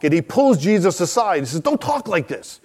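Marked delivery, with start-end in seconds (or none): 1.6-2.6 high, hushed protest to a respected teacher; urgent, higher-pitched remonstration; Peter. Retold or imitated. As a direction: take him aside and object in a higher voice. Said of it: "Peter doesn't like this talk".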